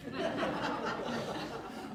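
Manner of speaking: quick airy laugh; breathy laugh. Real, not acted. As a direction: laugh softly through the nose. Laughs at own story.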